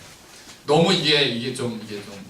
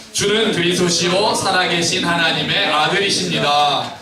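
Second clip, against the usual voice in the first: grand reading; 3 dB louder than usual. Shown as a demonstration. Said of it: "한번 멋지게 읽어볼까요".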